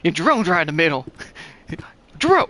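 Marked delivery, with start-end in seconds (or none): 2.2-2.5 high, loud startled squeak; sudden shocked yelp; shocked question. Acted. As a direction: blurt it out in disbelief. Shocked at drone.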